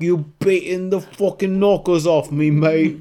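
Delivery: high, loud, drawn-out British caricature; mock British accent. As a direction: belt it like a mock Brit. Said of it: "They sound like the British sound like to us".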